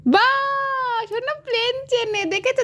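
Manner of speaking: high, sing-song praise; delighted praise. Real, not acted. Mother telling grandma proudly, high and delighted.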